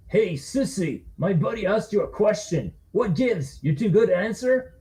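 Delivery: picking a fight; taunting drunk. Acted. Second bully taunts.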